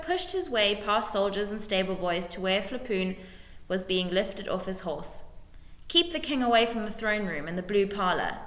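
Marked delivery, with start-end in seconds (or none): none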